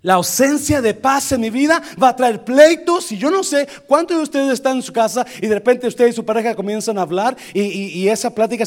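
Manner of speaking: loud, high, fast-building sermon; forceful pulpit voice, picking up speed; impassioned preaching. Real, not acted. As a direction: press the congregation with rising urgency.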